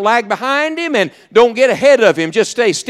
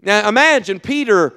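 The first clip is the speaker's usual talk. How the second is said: ordinary talk, nothing marked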